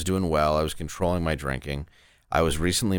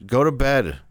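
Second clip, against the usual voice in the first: louder, higher curt dismissal; annoyed; 6 semitones higher, 3 dB louder than usual. Retold or imitated. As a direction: shut him down coldly. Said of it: "I'm like".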